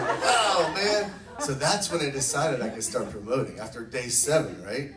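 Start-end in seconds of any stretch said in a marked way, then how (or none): 0.0-1.1 high, loud wowed reaction; retold astonished cry; amazed exclamation. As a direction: burst out wowed. Retelling reaction to friends' answer.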